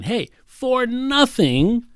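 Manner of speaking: slow, high, loud call of "Hey, for nothing"; almost shouting. Retold or imitated. Preacher voicing the verse as a shout to his listeners, slow and high. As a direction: call it out like the text is shouting at you. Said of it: "It's like it's almost shouting".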